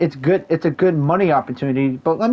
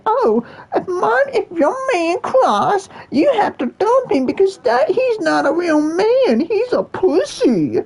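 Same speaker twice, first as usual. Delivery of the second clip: high slow whiny falsetto; mock woman's voice; slower than usual. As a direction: parody a woman telling friends to dump him. Mocking women online.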